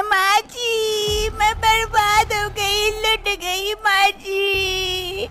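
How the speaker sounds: slow wailing sobs; a daughter-in-law wailing over her stolen egg; crying. Acted. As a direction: drag each word out through tears. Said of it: "वो जोर जोर से रोने लगती है".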